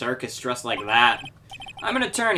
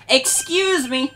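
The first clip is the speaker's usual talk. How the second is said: high, slow call across the room; 11 semitones higher, 4 dB louder, slower than usual. Acted. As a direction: call high and drawn out to get attention. [name] calls out.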